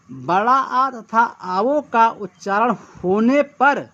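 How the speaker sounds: slow, measured reading of a rule; slow dictation. Shown as a demonstration. Teacher dictating the second shorthand rule slowly. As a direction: read the definition out slowly for note-taking. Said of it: "अब ये बड़ा आ".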